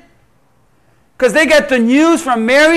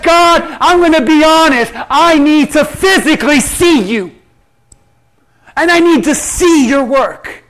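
a believer's prayer swelling from hush to outcry; pleading prayer; 3 semitones higher, 5 dB louder than usual. Acted. Preacher voicing a believer's prayer.

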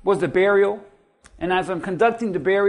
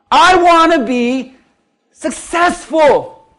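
high, slow, drawn-out mockery; slow mocking echo; 7 semitones higher, 7 dB louder, slower than usual. Preacher mocking her boast again, slow and loud. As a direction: stretch successful with scorn. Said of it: "that idiotic comment".

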